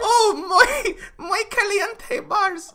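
very high fake Spanish accent, cracking up; laughing mock Spanish accent. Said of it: "why am I doing an accent?".

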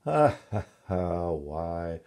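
acted laugh leading into speech; hearty storybook laugh; laughing king. A narrator's reading. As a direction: laugh out loud as the amused king, then begin the tale. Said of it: "he began to laugh".

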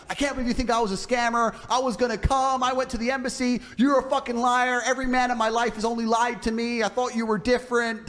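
hammed-up tearful girl accusing him; mock hurt girl. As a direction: act offended to flip it on him. Girl voiced in quarrel.

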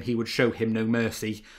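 ordinary delivery

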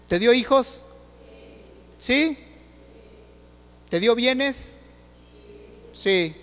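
loud, high, driving sermon voice; full-force preacher calling out; fiery preaching. Acted. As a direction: preach it hard at the congregation.

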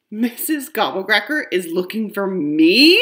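can't believe it's about me; amazed. Acted. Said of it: "I ask in amazement".

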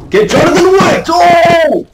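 piercingly high, very loud yell; explosive high shout ending in a long oh; all-out shout. Real, not acted. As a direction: scream it at the beaten opponent. Triumphant shout at game opponent.

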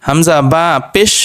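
usual speaking voice

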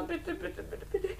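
quiet, breaking pleas; Dolly begging Verena, hushed; soft begging. Acted. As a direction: beg under your breath.

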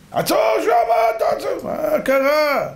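high, drawn-out call to stop; imitated screaming. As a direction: holler from afar in a high, stretched voice. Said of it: "שומעים צרחות". (צעקת עצירה גבוהה ומתוחה)

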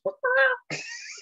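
very high-pitched chicken squawk; chicken impression. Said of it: "started doing a chicken impression".

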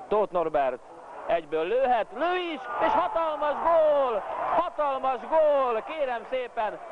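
goal call, high and drawn out; excited hungarian sports commentary. Acted. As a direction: shout the goal slowly, high pitched.

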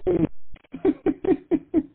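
high happy burst of laughter; relieved laugh. Real, not acted. Relieved the guest arrived.

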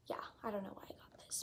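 fast, hushed whisper to herself; whispered aside. Real, not acted. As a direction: drop to a quick secret whisper. Muttered aside to self.

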